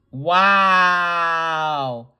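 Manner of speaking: loud, long drawn-out wow; indignant wow. Real, not acted. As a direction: stretch out an appalled "wow". Indignant reaction to the video.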